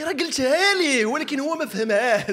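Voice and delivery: high, loud, slow exasperated reply; mock exasperated, drawn out; mock exasperated protest. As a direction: plead it was already said, slowly. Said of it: "تيقول لي".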